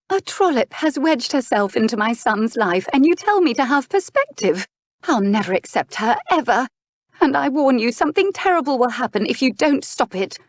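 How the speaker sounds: high, indignant warning; outraged duchess. Acted. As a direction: rail at your brother in a high voice. Duchess rages at brother.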